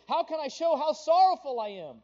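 high, loud mock-anguished cry; mourner's lament. Preacher mimics pagan mourners, high loud mock anguish. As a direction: ham up a grieving worshipper's plea. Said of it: "they say".

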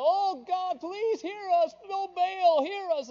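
high, loud pleading cries to Baal; desperate crying out. Retold or imitated. Prophets of Baal on Mount Carmel, high loud frantic pleading. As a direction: wail it up to a silent god. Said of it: "they cried out to Baal".

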